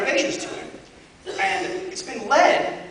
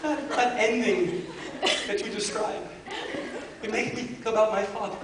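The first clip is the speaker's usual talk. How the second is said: imitating teary Pedro; 4 dB louder, slower than usual. Pedro tearfully confessing, retold.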